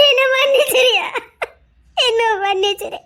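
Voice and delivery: half-laughing through sobs, pitch up; sobbing apology. Acted. Mother sobbing to her son, begging forgiveness. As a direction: break into tearful pleading laughter.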